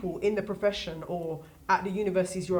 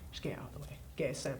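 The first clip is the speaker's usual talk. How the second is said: 1 whisper; 4 semitones lower, 10 dB quieter, faster than usual. Real, not acted. Advice trailing to whisper.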